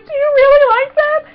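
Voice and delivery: high, loud excited question; incredulous fangirl pitch; thrilled disbelief. Retold or imitated. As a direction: squeal the question at your idol. Retold thrilled question to idol.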